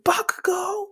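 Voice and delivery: high-pitched shouted name, imitated; acted-out call to someone leaving. Group calling after Bakugo.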